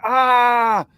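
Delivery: long, high, loud "aah"; imitating a panicked caller's scream; mock scream. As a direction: scream out one drawn-out cry. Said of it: "and he's screaming".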